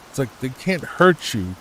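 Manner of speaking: usual speaking voice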